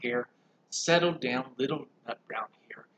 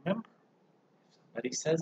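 ordinary delivery, nothing marked

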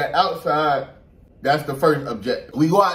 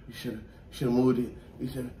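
softer, fading mock mutter; mock mumbling; 4 semitones lower, 9 dB quieter, faster than usual. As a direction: imitate her grumbling under her breath. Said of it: "You're like".

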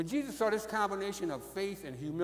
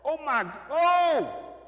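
much higher, louder, drawn-out oh my; showing the congregation how excitement sounds, loud and slow; excited exclaiming; 7 semitones higher, 7 dB louder, much slower than usual. Shown as a demonstration. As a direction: gush it out like you're overjoyed. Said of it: "what people say when they're excited".

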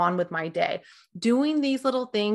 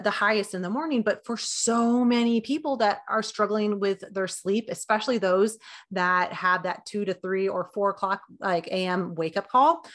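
usual speaking voice

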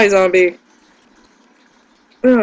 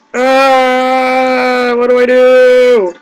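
panicked question; 5 semitones higher, 4 dB louder, much slower than usual. Real, not acted. Surrounded by eyeballs and zombie.

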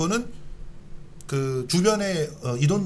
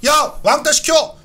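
shouting; 10 semitones higher, 5 dB louder than usual. Retold or imitated. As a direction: yell out the targets. Mimics students naming targets. (높게 외치는 목소리)

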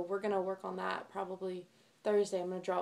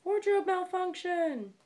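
mock alarm; 9 semitones higher, 6 dB louder, slower than usual. Real, not acted. Zipper stuck on camera.

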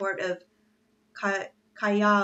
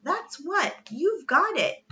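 high, bursting excitement; exclaiming; 9 semitones higher, 5 dB louder than usual. A narrator's reading. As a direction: exclaim like a boy struck by an idea. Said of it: "he exclaimed".

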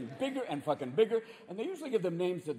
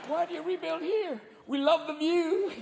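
storm-victim interview; 10 semitones higher than usual. Acted.

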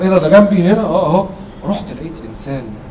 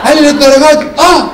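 escalating comedy rant; 8 semitones higher, 3 dB louder than usual. Retold or imitated. Call dialogue building up.

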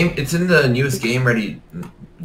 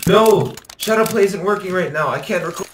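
frustrated shout; 8 semitones higher, 3 dB louder than usual. Real, not acted. Frustrated recording fails.

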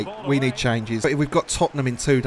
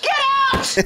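shouting; 4 dB louder than usual. Retold or imitated. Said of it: "Like when you threw Whitney out".

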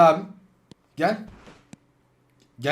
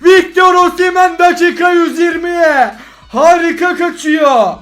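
screaming football commentator; 7 semitones higher, 11 dB louder than usual. Acted. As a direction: call the goal like a TV commentator. Calls his own winning goal.

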